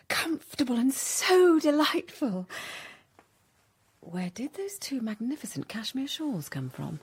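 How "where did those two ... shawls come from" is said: soft, low covetous whisper. Acted. Becky asking Amelia about the shawls in a whisper. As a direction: breathe it so only Amelia hears.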